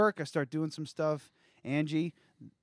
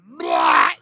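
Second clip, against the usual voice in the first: loud retching gag; retching heave; 17 dB louder than usual. Retold or imitated. Vomiting sound in story.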